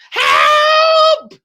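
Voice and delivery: high, loud shrieked help; scream. Acted. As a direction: shriek in panic. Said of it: "screamed Missus Twit".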